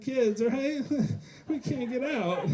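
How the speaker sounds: high, breaking laughing voice of a worn-out parent; helpless laugh. Retold or imitated. Worn-out parent to comedian, helpless laughing. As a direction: laugh through it like you're trapped.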